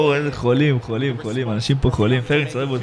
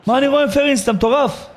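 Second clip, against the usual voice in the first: excited disbelief; 9 semitones higher than usual. Real, not acted. Streamer reacts to big gift.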